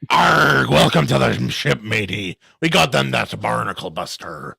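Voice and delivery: mock pirate voice. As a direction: play a hammy pirate welcoming a shipmate. Joins barnacle joke as a pirate.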